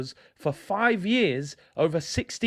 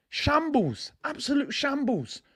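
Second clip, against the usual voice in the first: high-pitched cry of disgust; a fan's pitched-up disgusted verdict; outraged disbelief; 9 semitones higher than usual. Real, not acted. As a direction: fling it up high in exasperation. Outraged verdict on Xhaka's comment.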